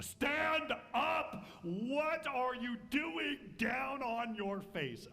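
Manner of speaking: commanding shout. Acted. God calling Joshua up off his face, booming. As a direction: order him up off the ground. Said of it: "The Lord said to Joshua".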